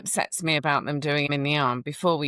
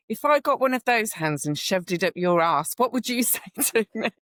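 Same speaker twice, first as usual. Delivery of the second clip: higher and louder, furious dressing-down; furious telling-off; 8 semitones higher, 3 dB louder than usual. Retold or imitated. Nurse retelling telling off a doctor for his patient, furious. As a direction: tear into him, How dare you?. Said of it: "confronting the doctor".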